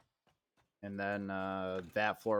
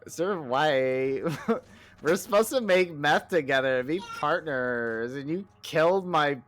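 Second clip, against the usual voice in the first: louder mock valley girl complaint, slowly, with a laugh inside; valley girl Jesse; 4 semitones higher, 6 dB louder, slower than usual. Joking with a teammate, laughing valley girl complaint. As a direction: whine at Walter like a betrayed valley girl, cracking up. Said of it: "replaced with a valley girl".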